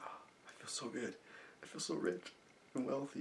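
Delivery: very soft, near-whispered contentment; hushed, inward bliss; blissful affirmations. Shown as a demonstration. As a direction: savour each feeling quietly, unhurried. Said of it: "another example of how I do this".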